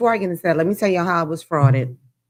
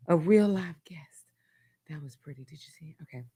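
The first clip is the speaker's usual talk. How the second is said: whisper; 2 semitones lower, 5 dB quieter, faster than usual. Real, not acted. Hushed aside to viewers.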